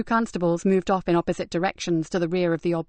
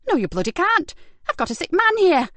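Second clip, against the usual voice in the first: much higher and louder, defiant; angry refusal; 11 semitones higher, 4 dB louder than usual. Acted. Woman blocking police at her door, angry and loud. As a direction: bar the door, furious.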